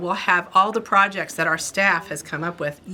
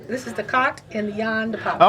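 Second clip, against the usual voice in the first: put-on playful Boston accent, Rs dropped; mock Boston accent; 2 semitones higher than usual. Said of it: "If you in Boston".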